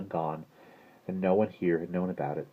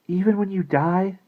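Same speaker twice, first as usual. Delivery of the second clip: put-on high, loud little-girl voice; child's insistent question; 14 semitones higher, 7 dB louder than usual. A narrator's reading. Little sister tests Maggie's promise, high childlike insistence.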